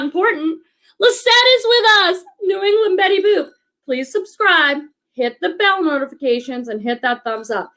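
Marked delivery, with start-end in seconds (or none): 1.0-3.5 excited. Real, not acted. Excited greeting of arriving friend.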